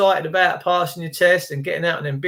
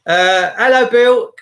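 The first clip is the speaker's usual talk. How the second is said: raised, brighter phone greeting; calling out a hello to Bill; loud hello; 5 semitones higher, 7 dB louder, slower than usual. Real, not acted. Greeting Bill on the phone, loud and bright.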